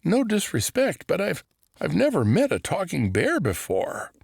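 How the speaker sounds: put-on high, polite, nervous young voice; a boy politely amazed; lightly voiced nervous boy; nervous boy Orson.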